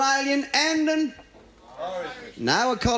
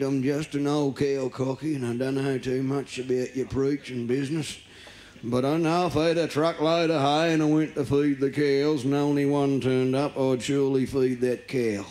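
put-on old farmer; 5 semitones lower than usual.

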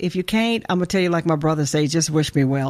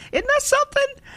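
amazed. Real, not acted. Mock-amazed aside about the critics.

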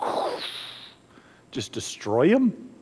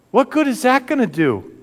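high, pressing disbelief; incredulous outcry; 7 semitones higher than usual. Acted. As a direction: throw the question out to the crowd. Preacher repeats question, then soft.

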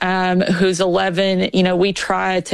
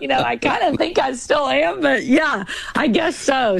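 quoting dad; 6 semitones higher than usual. Retold or imitated. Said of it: "he's like".

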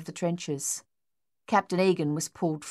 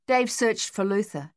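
ordinary delivery, nothing marked

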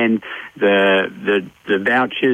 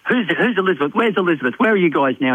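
higher, mock-alarmed wife demanding answers; 6 semitones higher, faster than usual. Wife texting her husband, hammed-up higher alarm. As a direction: play the suspicious wife, pitched up in mock alarm.

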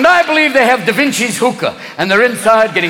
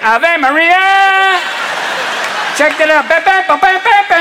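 higher, drawn-out mock Latin party shout; higher festive shout with horn-like ba ba ba; Latin American pope; 8 semitones higher than usual. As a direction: cruise the Popemobile like a party host.

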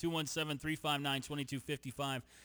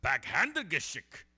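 mangled German imitation; 3 semitones higher, 5 dB louder, much slower than usual. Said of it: "Say it one more time".

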